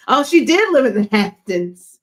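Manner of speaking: loud, high burst of sudden recall; excited realisation. Real, not acted. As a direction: blurt it out as it hits you. Sudden realisation mid-story.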